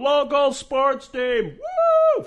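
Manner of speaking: high, slow, loud sports chant; mock fan cheer. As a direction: whoop it up like a parody sports fan. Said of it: "going over to a sports team and going".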